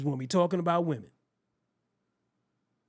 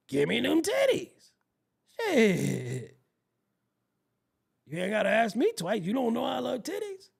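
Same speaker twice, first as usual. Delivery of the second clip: mock eager shout; 3 semitones higher than usual. Acted quote to supermarket lady.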